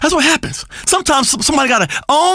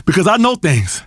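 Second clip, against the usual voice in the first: normal talking voice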